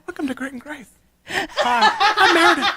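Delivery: put-on high, breathy girly voice with a laugh; sweet podcast-host girl voice; Meredith impression. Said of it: "That's my Meredith's voice".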